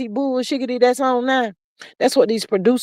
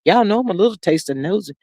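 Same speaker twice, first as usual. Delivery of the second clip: normal talking voice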